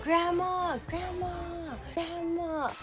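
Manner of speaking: high, drawn-out mock calling; re-enacted high-pitched holler; retold screaming. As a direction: call out for a lost grandma, without full force. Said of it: "screaming. I was like".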